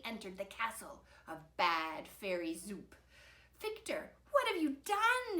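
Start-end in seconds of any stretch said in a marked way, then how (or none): 3.6-5.4 high, dismayed call; distant calling. A narrator's reading. Mother calls after Victor in the castle, distant calling. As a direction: call out as if from far away. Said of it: "his mother calling in the distance".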